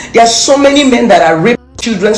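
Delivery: ordinary delivery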